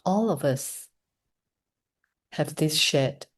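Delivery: soft, unhurried meditation voice with long pauses; hushed, measured invitation to reflect; guided meditation. Real, not acted. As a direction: guide them gently inward, leaving space between phrases. Leads guided meditation after the talk.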